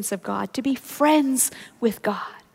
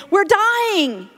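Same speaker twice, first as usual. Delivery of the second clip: panicked cry; 6 semitones higher than usual. Retold or imitated. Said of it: "disciples were saying".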